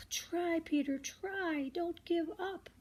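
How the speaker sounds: high little bird voices urging; urging sparrows. Acted. Sparrows encouraging the trapped Peter, high voices. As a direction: cheer him on like tiny birds.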